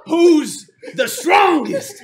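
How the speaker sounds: slow, high, very loud call; rallying shout. Acted. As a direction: stretch out the boast to the crowd. Dwarf rallying his table.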